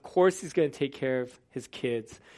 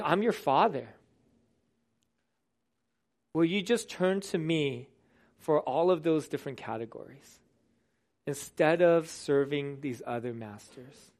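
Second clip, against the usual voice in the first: tender father; 2 semitones lower, 6 dB quieter than usual. Retold or imitated. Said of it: "And God's saying".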